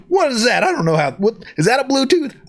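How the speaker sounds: hammed-up bewildered questions; mock confusion. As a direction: play the cowboy baffled by technology. Mock bafflement at Bluetooth.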